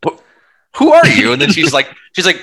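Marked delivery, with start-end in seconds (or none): 0.1-1.3 very high, loud stammered question; mock terrified son. Son backing away from the attacker, stammering terror. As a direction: squeal it in fake terror.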